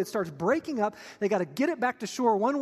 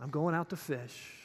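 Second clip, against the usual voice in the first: low, subdued, withdrawn; hushed, low resignation; gone silent; 5 semitones lower, 7 dB quieter than usual. Acted. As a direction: say it quietly, sinking back into yourself. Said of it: "and he gets silent".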